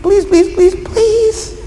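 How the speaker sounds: high-pitched pleading, climbing on the last pleases; hammed-up plea for mercy; begging and pleading. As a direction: beg desperately, voice rising to a squeak at the end. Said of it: "He's begging and pleading".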